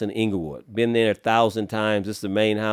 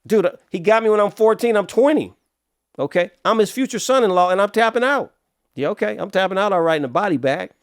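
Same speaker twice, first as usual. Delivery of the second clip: agitated; 9 semitones higher, 3 dB louder than usual. Real, not acted. Agitated telling of danger.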